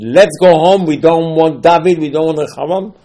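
loud rebellious crowd cry, high and loud; retold shout. All of Israel rebelling against Rehavam, loud shout. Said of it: "they shouted, they screamed".

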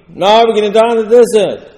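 loud, high-pitched protest; indignant retold outburst; acted outraged complaint. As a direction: cry out in mock grievance. Acted complaint of the rebels to Moshe.

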